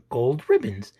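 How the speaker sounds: plain reading voice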